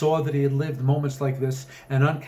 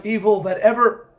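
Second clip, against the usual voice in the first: rising high and loud to a climax; dramatic build; 9 semitones higher, 9 dB louder than usual. Acted. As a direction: swell into the darkest word. Colonel's tale climaxes, cut off.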